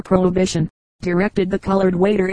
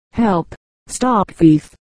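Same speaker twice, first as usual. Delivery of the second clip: slow, stretched mock cry for help; understated alarm, each word drawn out; mild splutter; much slower than usual. A narrator's reading. Little fellow caught by the fat man, mildly spluttered alarm. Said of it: "the little fellow spluttered".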